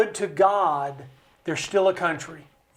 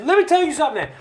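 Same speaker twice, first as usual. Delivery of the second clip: mock yelling; 6 semitones higher than usual. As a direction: play the congressman shouting for his voters. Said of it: "he yells and he screams for us".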